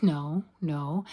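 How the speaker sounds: normal talking voice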